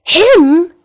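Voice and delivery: high, loud disbelieving yelp; shocked outburst at the sight of Dazai; incredulous scoff. Acted. Chuya scoffs to Koyo at his partner, high and loud. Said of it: "He scoffed in disbelief".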